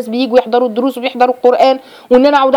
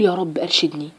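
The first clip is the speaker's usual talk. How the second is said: quiet prayer; 6 semitones lower, 9 dB quieter than usual. Retold or imitated. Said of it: "أصلي وأقول".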